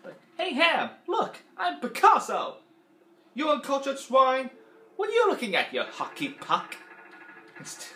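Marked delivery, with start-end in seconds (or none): 0.4-6.8 high, slow gruff Don Rickles-style insults; Mister Potato Head voice. Retold or imitated. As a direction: bark it like a cranky toy. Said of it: "I sound a bit like Don Rickles when I do Mister Potato Head".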